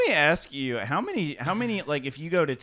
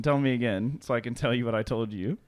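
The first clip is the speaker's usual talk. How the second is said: ordinary talk, nothing marked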